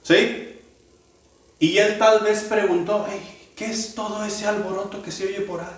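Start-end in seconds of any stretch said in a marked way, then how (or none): 3.1-5.7 curious beggar asking. Acted. Bartimeo voiced asking about noise.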